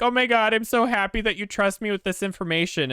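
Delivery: imitating an overcome sister, pitched high; gushing high-voiced relief, no real sobs; mock tearful joy. Sister reacting to being trusted, mock-emotional gushing. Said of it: "broke down and was crying".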